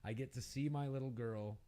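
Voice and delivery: fast, eager and bright; father telling the cashier his daughter visits, eager; excited father. Acted. Can barely contain his joy, rushing.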